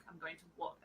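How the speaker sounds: "walk" with a long open vowel, very soft; least-effort Aussie "walk", trailing softly; lazy Australian pronunciation. Shown as a demonstration. Demonstrating as little effort as possible, soft Australian "walk". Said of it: "As little effort as possible".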